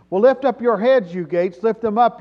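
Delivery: loud, high declamation; ringing psalm command. Acted. Preacher reciting the psalm, loud commanding call. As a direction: proclaim it forcefully to the gates.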